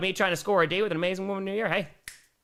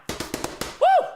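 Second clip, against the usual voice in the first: excited woo. Real, not acted. Celebrating a viewer's new grandson.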